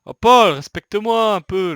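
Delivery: loud slow protest. Real, not acted. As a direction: call out at a friend, slow. Shouting at teammate in game.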